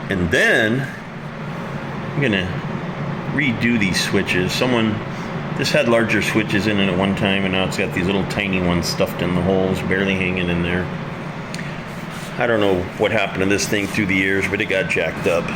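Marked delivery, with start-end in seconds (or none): none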